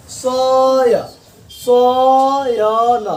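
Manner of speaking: सयन stretched very long to show अय; very slow demo. Shown as a demonstration. Teacher drawing out सयन to show अय to students. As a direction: draw सयन out as long as you can. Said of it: "अय आ रहा है".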